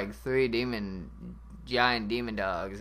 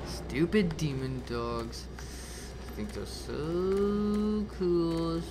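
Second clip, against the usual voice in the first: slow, stretched-out grumbling; very slow, sulky jeer; mocking grumble; 4 semitones higher, much slower than usual. Real, not acted. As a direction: drag the words out with scorn. Mocking the dogs after dying.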